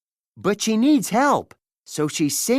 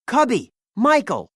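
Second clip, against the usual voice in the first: raised call of names; shouting; 6 semitones higher, 4 dB louder than usual. Acted. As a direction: call across the deck. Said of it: "shouts Jake".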